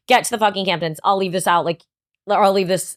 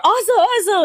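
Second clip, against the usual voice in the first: calling the dog; 13 semitones higher, 3 dB louder than usual. Retold or imitated. Reed calling the dog outside.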